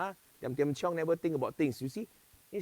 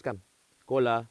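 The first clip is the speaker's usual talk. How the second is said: usual speaking voice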